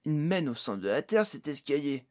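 loud, exasperated exclamation; crying out. A narrator's reading. Pod protesting the long stairs down, exclaiming. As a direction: complain loudly in character. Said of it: "s'écria Pod".

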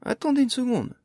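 loud, high plea for calm; urgent appeal. A narrator's reading. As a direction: call out to halt a confrontation. Calling a halt to hostile dwarves.

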